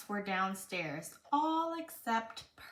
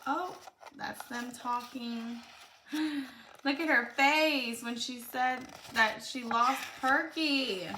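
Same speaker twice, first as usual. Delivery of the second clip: ordinary delivery, nothing marked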